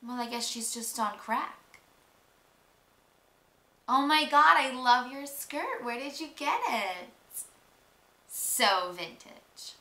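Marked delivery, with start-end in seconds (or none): 3.9-9.7 loud gushing, slow, fake sweet. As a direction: gush falsely over her skirt. Fake compliment then insult.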